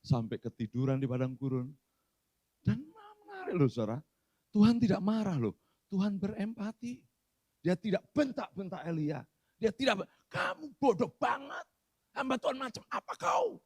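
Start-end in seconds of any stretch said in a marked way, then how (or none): 2.6-13.6 put-on high, harsh Batak-accented scolding; Batak style. Pastor mimics a Batak God berating Elia. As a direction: scold like a blunt Batak preacher. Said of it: "ini gaya Batak ini".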